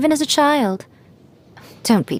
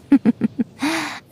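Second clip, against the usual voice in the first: light sly higher giggle; teasing laugh; 6 semitones higher than usual. Acted. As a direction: laugh playfully at the idea. Teasing about shrine maiden.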